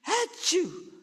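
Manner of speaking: high, hammed-up sneeze; mother sneezing at the idea of a cat; fake sneeze. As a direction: act out a big sneeze. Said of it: "I'm sneezing already".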